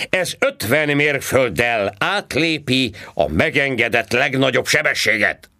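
high, loud mocking cop voice; sarcastic policeman. A narrator's reading. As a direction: needle him like a smug traffic cop. Policeman lecturing. (gúnyos rendőrhang, magasan és hangosan)

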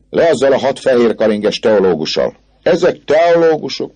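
scoffing student cry; crowd jeering. Acted. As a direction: heckle with mock disbelief. Crowd jeers at theologians.

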